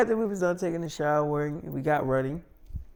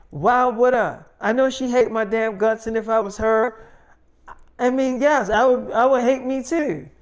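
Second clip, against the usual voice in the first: loud, pitched-up retort; raised voice, exasperated; heated admission; 5 semitones higher, 5 dB louder than usual. Retold or imitated. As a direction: imitate a daughter arguing back forcefully. Daughter argues back, heated.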